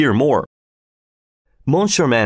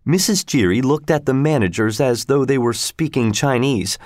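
ordinary delivery

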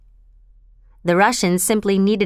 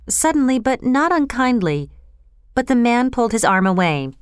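plain reading voice

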